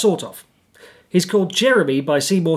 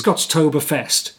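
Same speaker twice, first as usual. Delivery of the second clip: normal talking voice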